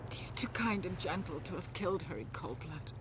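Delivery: hushed, breathy plea; whispering. Acted. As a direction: whisper it like a secret. Pleading wife defends husband.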